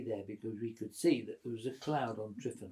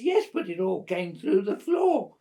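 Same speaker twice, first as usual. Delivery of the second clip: high, loud, drawn-out mock protest; imitated girl's protest; 8 semitones higher, 12 dB louder than usual. Girl complaining about her flooded tent, imitated protest. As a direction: wail it slowly as the exasperated girl. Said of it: "So she says".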